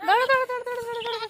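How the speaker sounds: very high, rapid-fire urging; high excited run of repeats. Real, not acted.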